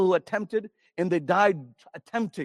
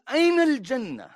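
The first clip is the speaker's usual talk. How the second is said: mocking taunt; 6 semitones higher, 4 dB louder, faster than usual. Enemy mocks paradise belief.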